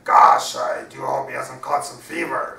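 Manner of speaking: a lightly voiced pirate, burst on Gosh. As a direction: voice the pirate with a sudden loud Gosh. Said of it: "let me do the voices".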